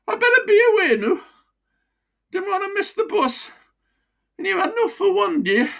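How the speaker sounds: put-on high, broad Wearside voice of an old miner; chatty old pitman with a wheezy chest. Old miner to his doctor, broad Wearside. Mickey leaves.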